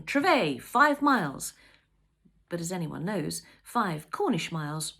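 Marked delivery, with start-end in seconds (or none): 0.0-1.5 higher, louder, like reading out a signpost; bold announcement. A narrator's reading. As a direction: announce it boldly. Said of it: "Signposts boldly announcing".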